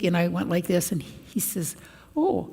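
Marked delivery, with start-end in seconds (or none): 2.2-2.5 amazed. Retold or imitated. Frail man amazed walking.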